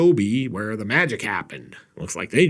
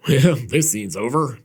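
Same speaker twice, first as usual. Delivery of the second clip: ordinary talk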